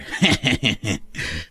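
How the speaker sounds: low, smug chuckle; smug laugh. Acted. Husband claiming the car from his wife, smug laugh. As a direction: chuckle in self-satisfaction.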